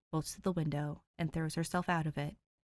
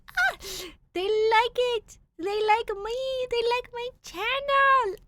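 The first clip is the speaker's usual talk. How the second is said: much higher, louder, slow gushing; 7 dB louder, slower than usual. Retold or imitated. The reader, voicing her own joy at subscribers, high gushing. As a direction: gush with disbelieving joy. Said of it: "I'm like".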